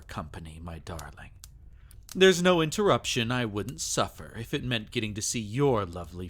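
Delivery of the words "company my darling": breathy whisper, leaning in close. Acted. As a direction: whisper it right into her ear. Intimate aside to his pet.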